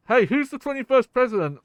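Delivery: high, loud call over the shoulder. Retold or imitated. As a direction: shout the question back at the guy. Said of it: "and he goes".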